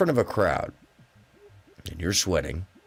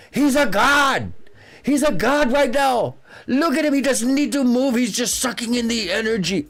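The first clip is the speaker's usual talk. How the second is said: [name]; 6 dB louder than usual. Retold or imitated. Imitating the singer thrilled by a star's stillness, awestruck high shouting. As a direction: exclaim in worship at the top of the voice. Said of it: "I'll say it like him".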